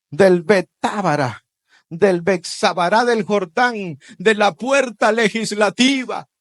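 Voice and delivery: loud, rising preaching; preaching at full force. Acted. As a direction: proclaim it to the congregation. (predicación a todo pulmón)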